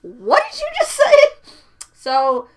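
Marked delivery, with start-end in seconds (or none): 0.0-1.1 very high, loud, incredulous question; shocked mom. Retold or imitated. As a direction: imitate a mother aghast at her child. Retelling mother's shocked reaction.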